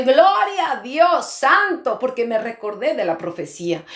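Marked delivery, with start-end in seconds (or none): 0.0-2.0 high, loud and drawn-out cry of praise; joyful praise. Retold or imitated. Preacher retelling her joy at the good news, exultant and drawn out. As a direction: stretch out gloria in overjoyed relief. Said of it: "Yo me alegré tanto y dije".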